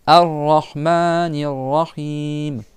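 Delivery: very slow "Ar-Rahmanir Rahim"; slow Quranic Arabic; Arabic recitation. Shown as a demonstration. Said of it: "tu dis Ar-Rahman".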